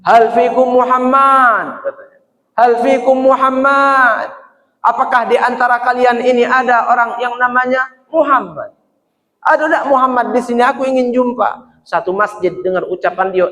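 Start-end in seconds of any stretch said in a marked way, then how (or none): 0.1-1.8 higher, louder, slow calling out; shouted call. Acted. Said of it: "teriak, Pak". 2.5-11.8 shouted call. Acted. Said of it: "teriak, Pak".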